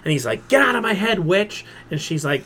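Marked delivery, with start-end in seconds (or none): 0.5-1.7 higher-pitched mock angry outburst; retold angry protest. Villain ordering a telepath out of his head, retold as a mild angry outburst. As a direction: throw it out as a villain's retort, lightly acted.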